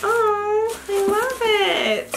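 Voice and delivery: high, slow gush; delighted gush over the gift. Real, not acted. Reacting to gift.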